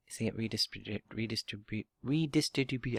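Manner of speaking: tripping over the syllables of "redistribute"; tongue-tied stumbling. Real, not acted. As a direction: stumble through "redistribute" in repeated failed attempts. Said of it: "I can't even say it".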